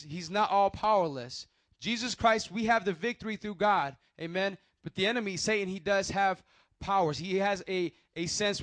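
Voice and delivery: firm preaching to the listeners; preaching emphasis. Real, not acted. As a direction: proclaim it to the congregation. Preaching point about the enemy.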